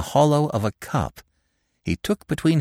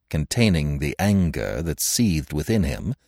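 ordinary delivery

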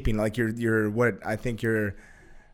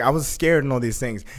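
usual speaking voice

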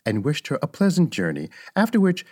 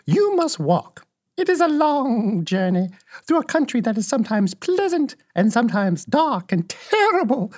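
put-on solemn old witch; 10 semitones higher than usual. Old witch describing the long journey, high solemn witch voice. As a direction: warn as a protective old witch, pitched high.

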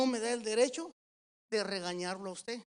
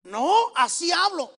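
loud, high, drawn-out retort; hammed-up slow, loud protest; shouting denial; 6 semitones higher, 9 dB louder, slower than usual. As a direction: imitate yelling while denying it. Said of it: "Papá, estás gritando".

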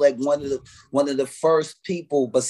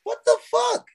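much higher voice of mock disbelief; incredulous squeal. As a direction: play it wide-eyed and squeaky. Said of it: "I'm like".

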